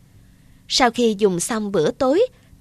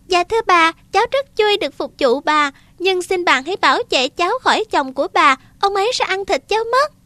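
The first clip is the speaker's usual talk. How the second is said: put-on high, quick pleading boy; a child trying to stay calm; 6 semitones higher, faster than usual. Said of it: "cố bình tĩnh và nói".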